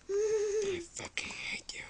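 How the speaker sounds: high whispered grudge. Real, not acted. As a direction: hiss it under your breath. Whispered insult at friend.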